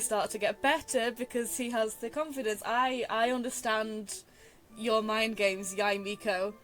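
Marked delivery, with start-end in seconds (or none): none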